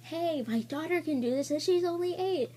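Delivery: high, far mock voice of the nurse. Nurse scolding the scared patient, high mocking imitation. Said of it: "she was just like".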